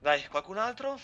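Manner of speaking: loud, high taunting challenge; angry sarcastic shout. Real, not acted. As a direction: yell it sarcastically at the screen.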